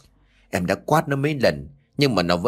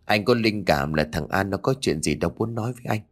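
usual speaking voice

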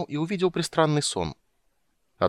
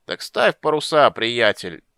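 put-on loud, slow drunken yell; bawling; 3 semitones higher, 6 dB louder, slower than usual. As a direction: roar it out drunkenly. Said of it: "орал Карамон".

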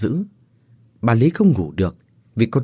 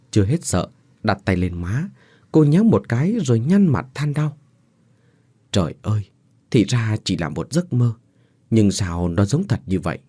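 ordinary delivery

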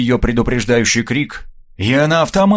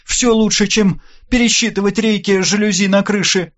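high-pitched, mildly grumbling woman's line; a touch of grumbling, pitched up; 7 semitones higher than usual. A narrator's reading. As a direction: grumble lightly in a raised pitch. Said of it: "проворчала Лина".

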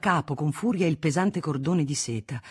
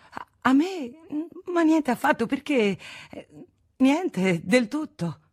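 flustered; 10 semitones higher than usual. A narrator's reading. Federico flustered denial.

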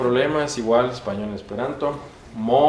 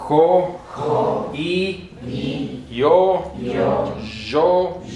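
higher, held syllables with pauses after; repeat-after-me drill; 4 semitones higher, much slower than usual. Shown as a demonstration. Teacher leads class repeating the alphabet, raised drill voice. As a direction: call out each letter for echoing. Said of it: "repitan conmigo".

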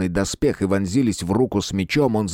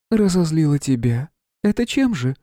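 high, disdainful queen voice; haughty queen; 7 semitones higher than usual. Acted. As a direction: sneer it like a disgusted queen. Queen mocking question.

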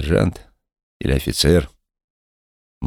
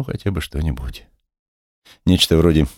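ordinary delivery

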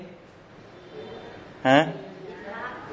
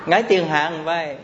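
normal talking voice

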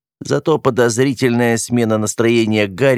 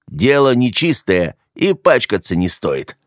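plain reading voice

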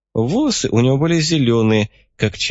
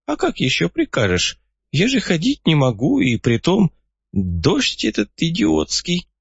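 put-on a crafty old man pleased with himself; 7 semitones higher than usual. A narrator's reading.

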